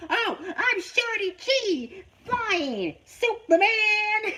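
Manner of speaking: high, slow cartoonish hero shout; mocking the wrestler's gimmick name as a high, slow cartoon hero. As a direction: ham up a silly kids-show superhero. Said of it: "she shout".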